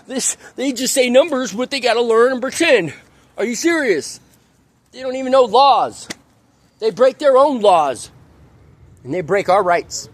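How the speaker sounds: high, heated outrage; indignant rant. Real, not acted. Angry rant about the cops.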